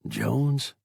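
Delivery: ordinary delivery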